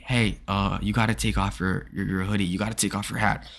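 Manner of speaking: quietly voiced teacher, low and soft; discreet teacher voice. Teacher taking a student aside about a hoodie, discreet and quiet. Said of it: "never wanna tell you privately".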